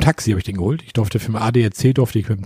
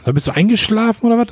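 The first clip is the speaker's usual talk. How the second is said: high, louder playful jab at a silent partner; teasing check-in; 10 semitones higher, 4 dB louder than usual. Real, not acted. To a silent co-host: high, louder teasing "you asleep?". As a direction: pitch it way up and needle him.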